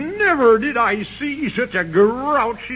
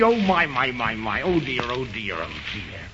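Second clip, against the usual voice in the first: sinking low and fading to a near-whisper; fading lament; 12 semitones lower, 5 dB quieter than usual. Acted. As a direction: let the sighs drop away to nothing. Man in the Moon despairs.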